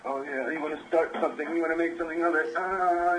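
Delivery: high-pitched tough-guy impression; mock tough guy. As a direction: imitate a lad itching to start something. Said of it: "kind of tough guy".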